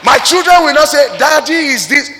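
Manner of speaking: loud and high, a father outraged; father vowing his children won't insult him, shouting; mock indignant shout. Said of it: "You say".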